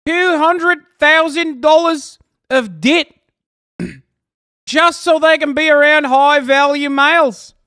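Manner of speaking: hammed-up Bill Burr rant. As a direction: rage at them like Bill Burr doing a bit. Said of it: "I'm turning into Bill, Bill Burr".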